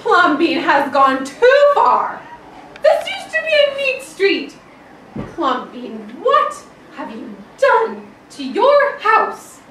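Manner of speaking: loud, high, slow outraged scolding; scolding neighbours. Acted. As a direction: tell him off like a shocked neighbour. Neighbours scold Plumbean in story.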